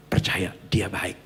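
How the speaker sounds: slow near-whisper; hushed, earnest. Real, not acted. Preacher urging listeners to trust God, slow hushed assurance. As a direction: drop low and soft, assuring them. Tender appeal to believe.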